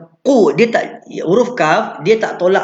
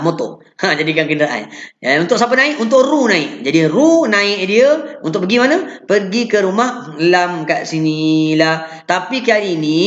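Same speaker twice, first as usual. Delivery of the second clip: ordinary talk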